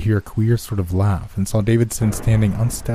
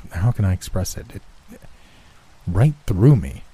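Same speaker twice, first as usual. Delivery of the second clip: usual speaking voice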